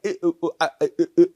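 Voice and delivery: stuttering "uh, uh, uh" like a broken video stream; choppy buffering audio. Retold or imitated. Imitating a stalled 1999 internet video. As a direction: mimic a video that keeps cutting out. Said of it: "it would kinda like".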